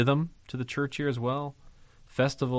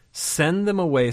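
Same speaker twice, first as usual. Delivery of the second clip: emphatic quote; 7 semitones higher, 5 dB louder than usual. Acted. Preacher voicing the disciples' demand.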